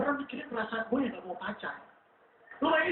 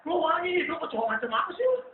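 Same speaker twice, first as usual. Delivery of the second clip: cockroach friends; 4 semitones higher than usual. Acted. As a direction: tease the perfumed one as a jeering roach. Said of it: "dia main kayak".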